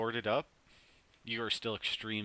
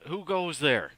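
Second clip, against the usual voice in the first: guard calling out; 8 semitones higher, 9 dB louder, slower than usual. Acted.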